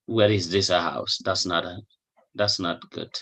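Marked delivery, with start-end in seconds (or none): none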